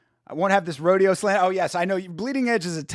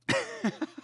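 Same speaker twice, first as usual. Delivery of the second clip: high wheezing laugh; 6 dB quieter than usual. Real, not acted. Laughing at donkey story.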